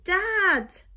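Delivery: calling out loud for a lost mum; shout. Acted. Boy alone on island, shouting for mum. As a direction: shout it across the empty beach. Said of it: "he shouted".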